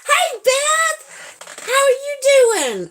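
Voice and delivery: very high, drawn-out hello; excited greeting. Real, not acted. Streamer greets viewer Beth, high excited greeting. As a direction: squeal a delighted welcome.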